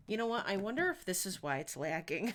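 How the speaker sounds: usual speaking voice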